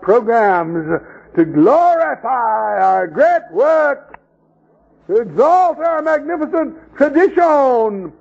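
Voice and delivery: high, slow, grandiose sarcasm; pompous mockery. Preacher mocking denominations' pride, slow and high. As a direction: ham up the self-praise.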